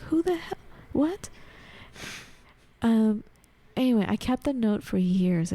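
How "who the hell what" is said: high, startled disbelief; baffled. Retold or imitated. Her reaction to the stranger's note, high and startled. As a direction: shoot up in pitch, stunned.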